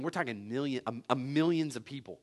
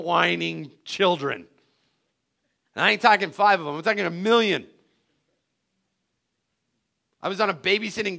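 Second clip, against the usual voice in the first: indignant Moses; 6 semitones higher, 10 dB louder than usual. Retold or imitated. Moses protesting to God, loud indignant rant. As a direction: rant about the forty years. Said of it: "How obedient has this guy been".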